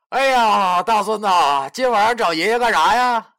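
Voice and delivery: 哈哈大笑着嘲讽; laughing taunt. Acted. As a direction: laugh in his face while teasing. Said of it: "哈哈大笑了起来".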